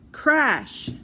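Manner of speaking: high, loud sudden bang word; loud crash. A narrator's reading. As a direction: burst it out like a crash. Sudden noise in story.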